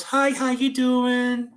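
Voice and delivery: high, slow, drawn-out hello; chirpy greeting. Retold or imitated. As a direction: imitate an overly friendly stranger. Normal third person's friendly greeting.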